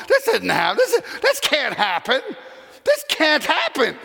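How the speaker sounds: high, slow, half-laughing disbelief; can't believe what they see; stunned disbelief. Retold or imitated. Crowd in disbelief.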